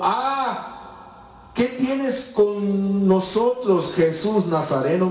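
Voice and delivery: high, drawn-out demon voice; demon crying out. Retold or imitated. Preacher voices the demon crying out at Jesus, high and slow. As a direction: cry out slowly and high like a tormented demon. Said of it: "dio voces diciendo".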